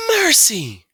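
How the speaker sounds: a loud, high "Mercy!"; shocked cry. A narrator's reading. Mother finding Stuart frozen, crying out loud and high. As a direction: gasp it out in alarm. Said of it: "she cried".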